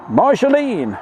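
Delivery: long, high, loud call of the name. Real, not acted. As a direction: sing out her name in greeting. Calling out a viewer's name.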